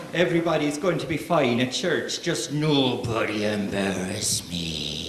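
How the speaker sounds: soft, slowing into low menace; hushed evil-genius threat, drawn out at the end; diabolical mastermind mom. Retold or imitated. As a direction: imitate mom's quietly sinister warning. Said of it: "the diabolical mastermind".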